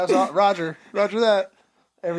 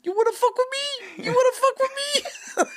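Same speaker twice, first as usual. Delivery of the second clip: much higher taunting sergeant voice; sergeant impression; faster than usual. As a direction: challenge like the cocky sergeant, pitched way up. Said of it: "He used to say that shit".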